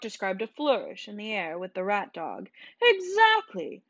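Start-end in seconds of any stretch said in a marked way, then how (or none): 2.8-3.8 much higher triumphant cry; high delighted exclamation; excited. A narrator's reading. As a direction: crow it out with glee. Bart flourishing the dog.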